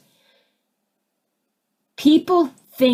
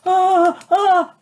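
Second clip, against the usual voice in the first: higher, louder startled "ah, ah"; mock gasp; 8 semitones higher, 5 dB louder than usual. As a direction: let out two sharp mock gasps. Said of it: "this goes".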